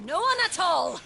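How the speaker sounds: furious shout. Acted. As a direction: scream it in triumph. Villain taunts, no help coming.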